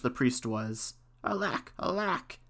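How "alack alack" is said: high, soft, slow wail of dismay; crying out. A narrator's reading. Astonished priest sees the kettle jump, crying out. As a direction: lament it high and drawn out. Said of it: "he cried".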